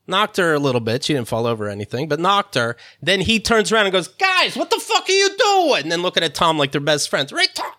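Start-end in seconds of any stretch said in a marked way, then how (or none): 4.1-5.8 high, drawn-out fake scolding; mock indignation. Paparazzo turning on the others for Tom's benefit, mock indignant. 7.3-7.7 high-pitched fake outrage; mock chummy protest. Paparazzo acting like Tom's best friend, mock protest.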